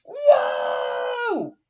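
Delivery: high shouted whoa; loud whoa. Acted. Reading aloud to kids, a high startled shout. Exclamation in story.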